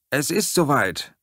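loud alarmed call. Acted. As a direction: shout it to Sam. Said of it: "rief ich Sam zu".

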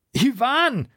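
desperate call across the beach; shout. Acted. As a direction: yell for a hurt friend. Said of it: "schrie ich laut".

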